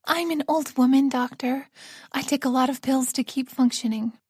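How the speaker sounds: lightly voiced frail old woman; a hint of a tired old lady.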